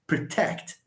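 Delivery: "Protect" stressed on TECT; stress on second syllable. Shown as a demonstration. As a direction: model pro-TECT for "Protect". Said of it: "stress falls on the second syllable".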